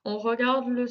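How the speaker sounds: ordinary delivery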